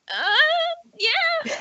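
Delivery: squeaky, unsure uh yeah; awkward high uh yeah. Acted. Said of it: "kind of like".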